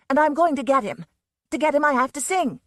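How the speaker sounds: loud, high, determined; won't be stopped; stubborn. A narrator's reading. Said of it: "Susan said stubbornly".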